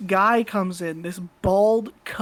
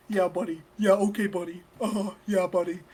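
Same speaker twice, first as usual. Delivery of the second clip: softer, smug condescending mutter; snotty mock; 4 dB quieter than usual. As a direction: sneer it quietly like a smug know-it-all. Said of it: "he does that, snotty thing".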